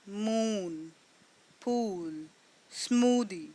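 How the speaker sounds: मून, पूल, स्मूदी with the ऊ drawn out; long dragged oo. Shown as a demonstration. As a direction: drag the ऊ long. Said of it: "ऊ जो है उसको हमने drag किया है".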